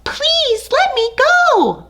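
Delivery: high squeaky cry for mercy; desperate tiny mouse plea. Acted. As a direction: cry out to be let go. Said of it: "Mouse cried".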